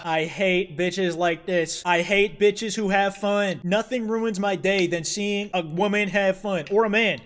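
slow, drawn-out disgusted tirade; hammed-up slow loathing; mock hateful rant. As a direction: grind out each word slowly, with disgust. Mock rant at cute character.

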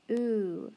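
a long drawn oo; teacher models the oo sound. Shown as a demonstration. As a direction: hold the oo, as in moon. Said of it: "oo sound".

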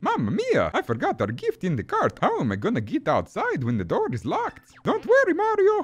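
high, bad cartoon Italian accent; hammed-up amateur Italian voice acting; Luigi, badly voiced. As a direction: fret like a cartoon plumber, climbing to a squeal. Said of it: "This voice actor for Luigi and Mario is really bad".

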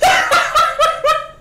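very high, loud squealing laughter; helpless shrieking cackle of real laughter; hysterical laugh. Real, not acted. Cracking up at the T-pose.

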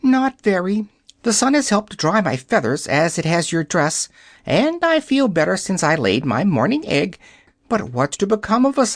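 high, quick fussy hen voice; a hen rattling off her ordeal; chattering yellow hen. Acted. Hen answers Dorothy.

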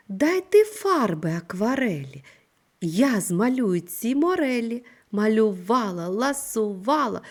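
teacher reads the rhyme out to her second graders, lively and rhythmic; recited tongue twister. Acted. Teacher shows second graders the tongue twister, chanting it with rhythm.

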